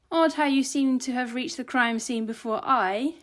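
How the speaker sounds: Bastien. A narrator's reading. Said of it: "in his thick Austrian accent".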